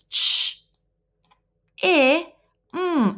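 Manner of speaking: put-on segmenting sounds for a child; sounding out sounds. Shown as a demonstration. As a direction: sound out Ch, I, mm one at a time. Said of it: "let me see if I can do this".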